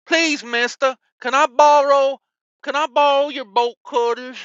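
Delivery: high, whiny begging voice; pleading beggar. Retold or imitated. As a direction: beg like a kid asking a favor. Begging shipper for bolt cutters.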